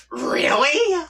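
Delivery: high, loud cry of disbelief; incredulous yelp. Real, not acted. Reading a weird comic line, a high incredulous yelp. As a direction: squeal it, can't believe what you read.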